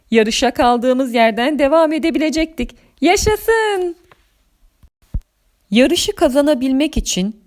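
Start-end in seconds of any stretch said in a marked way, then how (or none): 3.0-3.9 tiz, uzatılmış sevinç çığlığı; coşkuyla, tiz sesle haykır; sevinç nidası. Acted. Yarışa devam sevinci. (neşeli kutlama sesi)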